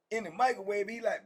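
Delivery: normal talking voice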